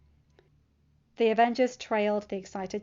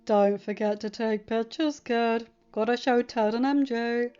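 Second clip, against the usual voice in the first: louder, playful teasing; teasing Tony; 2 semitones higher, 5 dB louder, slower than usual. A narrator's reading. Tony teases Peter on the plane, loud playful ribbing. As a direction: rib the kid from your seat. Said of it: "Tony teased from his seat".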